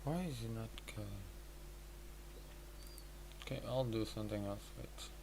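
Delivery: normal talking voice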